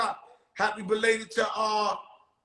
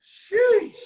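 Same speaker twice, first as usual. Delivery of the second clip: high sheesh; 12 semitones higher, 8 dB louder than usual. Real, not acted. Reacting to the joke.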